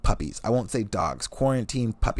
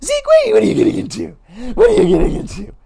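high, loud cooing at the dog; mock the date cooing at Zeke; a squealing dog mom; high baby talk to dog; 7 dB louder, faster than usual. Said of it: "Five minutes in".

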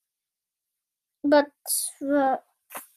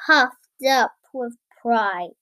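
ordinary delivery, nothing marked

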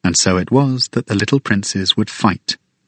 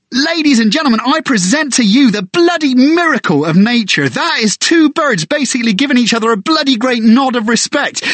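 excited bird guide; 12 semitones higher than usual. Acted. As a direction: announce the crow like a circus ringmaster. Guide commentating on the crow.

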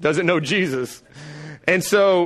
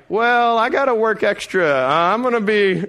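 higher, teasing escape; joking dodge; 7 semitones higher than usual. Retold or imitated. Husband joking to his wife about staying away, mock evasive. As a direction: pretend to stall going home. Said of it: "And I'm like".

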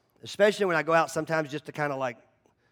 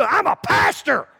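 loud, slow proud reveal; loud announcement; 9 semitones higher, 8 dB louder, slower than usual. Retold or imitated. Pastor revealing his job to golfer, loud and slow. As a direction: declare it boldly.

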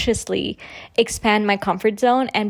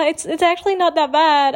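awkward laugh; 7 semitones higher than usual. Retold or imitated. Her own awkward quoted reply.

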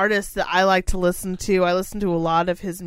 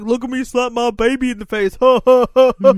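cackling mock parent, a bit higher; 3 semitones higher, 7 dB louder than usual. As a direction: laugh it up like a smug parent filming. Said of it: "They're like".

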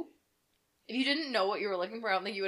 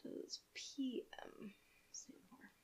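slow, soft whisper; 17 dB quieter, much slower than usual. Real, not acted. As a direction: whisper the question slowly. Reading search result, whispered.

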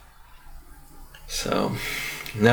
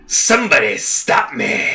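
lightly voiced Mask, slow and drawn out; a hint of Jim Carrey's Mask, savoring it; slower than usual. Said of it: "do the better Mask voice".

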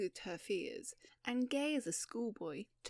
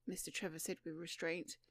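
usual speaking voice

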